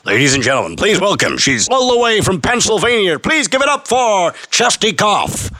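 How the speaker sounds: hammed-up compere introducing a cough; announcer. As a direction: hype up the cough like a headline act. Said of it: "announcing his cough like a star".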